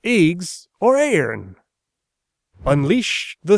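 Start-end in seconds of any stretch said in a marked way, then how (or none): 0.0-1.6 put-on a touch of an old-fashioned grumble; griping Caxton. Caxton complaining about eggs, a grumbling old printer. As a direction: complain in archaic English. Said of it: "Caxton griped".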